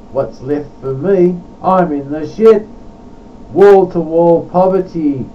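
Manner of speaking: emphatic rhymed verse, punching the key words; poetry recitation. Acted. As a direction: declaim a comic complaint in verse. Recites his own poem.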